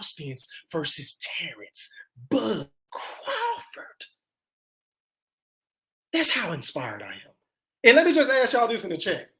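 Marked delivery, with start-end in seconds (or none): 0.0-7.3 slow hushed whisper to build hype; dramatic whisper. Real, not acted. Streamer whispering fighter names to chat, slow hushed whisper. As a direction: breathe the names out slowly, like a secret.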